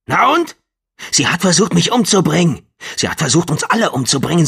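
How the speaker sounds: high, loud indignant outburst; angry. Acted. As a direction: hurl it at her, outraged. Furious man rants at patient.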